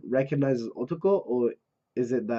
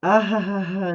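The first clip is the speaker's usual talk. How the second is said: raised, read-out laugh; acted laugh; 7 semitones higher, 5 dB louder than usual. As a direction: voice a character's ha-ha laugh. Voices laughter from the manga.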